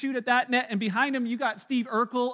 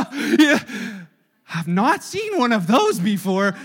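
mock wonder laughing; 8 dB louder, slower than usual. Mocking distracted congregation.

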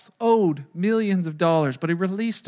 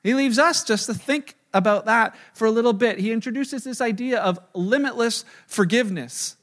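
normal talking voice

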